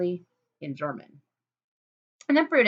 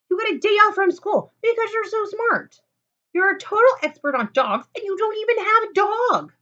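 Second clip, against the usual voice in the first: high, louder mocking rant; jealous rant; 11 semitones higher, 6 dB louder than usual. Lulu ranting at Fleshman about his perfection, high and loud. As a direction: spit out every complaint.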